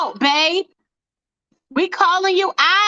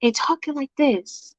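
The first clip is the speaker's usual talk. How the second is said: Michael voice imitation; 2 semitones lower, 4 dB quieter than usual. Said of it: "talking in Michael's voice".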